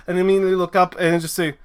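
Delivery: normal talking voice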